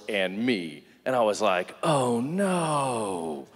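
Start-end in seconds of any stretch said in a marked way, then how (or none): 1.8-3.5 drawn-out, sinking cry of realization; mock-horrified lament, stretched out; dismayed kid. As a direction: wail it slowly, like awful news dawning. Child's dawning horror, retold.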